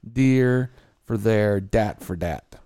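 "Deer" and "dat" with a hard d in place of th, slow; Yooper d for th. Shown as a demonstration. Demonstrating Yooper speech, d replacing th. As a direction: mimic the Yooper dialect's d sound. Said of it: "d" for the T H".

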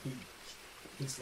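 usual speaking voice

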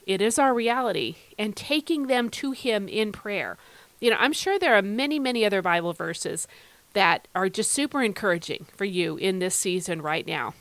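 ordinary talk

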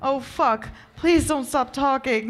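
high, loud begging; heated, high-pitched entreaty; urgent plea. Acted. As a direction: plead urgently, pitch lifted. Acted plea in intimate scene.

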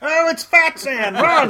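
high, loud, drawn-out cry of alarm; mock panicked shout. Friend teasing the former Tarzan, mock panicked shout. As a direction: shout a pretend warning as if a monster came.

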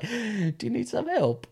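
high, slow put-on offer; put-on John voice. John turns up offering help, slow high put-on voice. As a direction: draw it out in a mock high voice.

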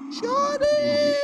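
high, drawn-out call across the room. Real, not acted. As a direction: holler the name out high. Calling out to a friend.

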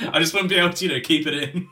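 ordinary talk, nothing marked

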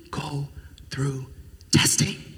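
dramatic whisper on go through testing. Acted. Preacher whispers the key word to congregation. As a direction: drop to a whisper for effect.

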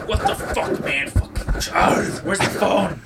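hushed, strained mock yell; distant yelling on phone. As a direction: imitate shouting far from the receiver. Imitates distant yelling heard on phone.

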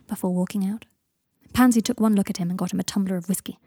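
ordinary delivery

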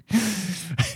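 airy high wheeze of a laugh; breathy laugh. Real, not acted. Recalling the neighbor, breathy laugh.

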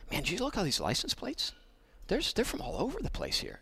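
quoting the mayor. Retold or imitated. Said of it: "and he said".

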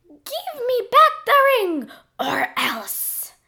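high, slow squeaky threat; threatening King Glob. Acted. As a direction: menace in a squeaky monster voice. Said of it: "Glob threatened".

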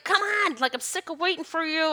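high, pleading and fed up; an eager soul nagging the body to hurry; impatient soul. Acted. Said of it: "the soul's like".

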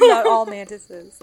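mock slogan.

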